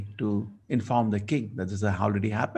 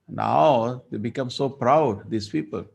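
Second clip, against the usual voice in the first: slow, weighed contempt; slow indignation; slower than usual. Acted. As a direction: draw it out with scorn. Son angry at king.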